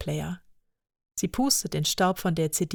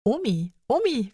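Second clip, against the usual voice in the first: put-on little girl calling; 5 semitones higher than usual. Lea calls grandma.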